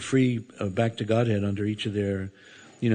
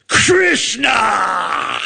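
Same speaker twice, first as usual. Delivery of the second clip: loud, much higher angry yell; angry shout; 10 semitones higher, 8 dB louder than usual. Retold or imitated. Furious wrestlers yelling after the fleeing devotee, loud high shout. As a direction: bellow it down the hallway. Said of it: "I heard".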